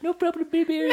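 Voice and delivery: very high mock baby talk; squeaky, sugary lover's baby voice in parody; teasing baby voice. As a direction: coo it mockingly, laughing. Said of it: "I heard you do it to Shannon".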